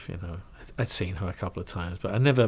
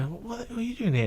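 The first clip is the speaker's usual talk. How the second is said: much higher, startled question; surprised Guy; 13 semitones higher than usual. Retold or imitated. Guy spotting him at the studio, startled. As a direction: act Guy's double take.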